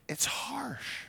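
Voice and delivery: stage whisper of disapproval. Real, not acted. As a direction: breathe it like a secret. Whispered comment on the king.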